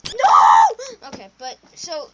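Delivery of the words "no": laughing loud shout. Real, not acted. Gamer reacting to a game fail, laughing shout. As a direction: yell it out, laughing.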